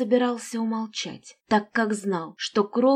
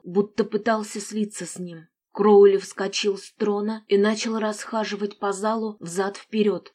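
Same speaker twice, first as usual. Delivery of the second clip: usual speaking voice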